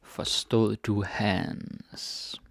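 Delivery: slow model reading. Shown as a demonstration. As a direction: stretch it out gently for learners. Teacher models the phrase slowly.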